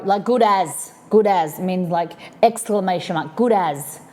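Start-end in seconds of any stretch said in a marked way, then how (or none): none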